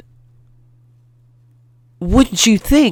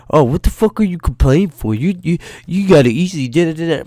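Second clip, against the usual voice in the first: higher, sneering imitation of a coworker; mocking coworkers; 5 semitones higher, faster than usual. Imitating coworkers mocking his complaints, higher. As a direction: mimic them whining back at you. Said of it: "I have people telling me".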